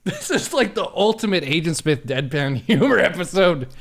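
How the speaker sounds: high, loud laughing speech; laughing hard. Real, not acted. Host cracks up at guest's deadpan silence, laughing hard. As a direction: talk through real helpless laughter. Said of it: "I'm laughing so hard".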